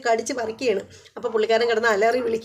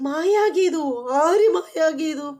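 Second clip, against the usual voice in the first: indignant echo; 6 semitones higher, much slower than usual. Retold or imitated. Geethu echoes, indignant.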